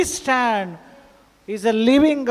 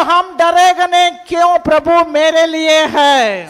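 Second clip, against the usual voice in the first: loud, raised, fearless; bold declaration; 5 semitones higher, 8 dB louder than usual. Shown as a demonstration. Pastor leading a fearless confession, loud. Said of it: "अंदर से बोलो".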